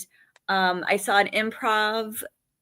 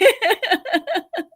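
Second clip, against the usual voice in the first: laugh; 10 semitones higher, 4 dB louder than usual. Real, not acted. Laughing at her own story.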